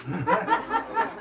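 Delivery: soft high laugh; real amused laugh; high burst of laughter. Real, not acted. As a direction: laugh at the absurdity. Laugh at own story.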